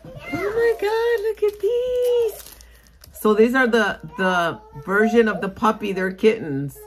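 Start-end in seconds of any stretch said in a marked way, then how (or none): 0.0-2.6 high, slow gushing; excited gush. Real, not acted. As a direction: coo over them, much higher and slower. Gushing over cute stickers.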